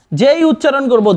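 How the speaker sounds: ordinary delivery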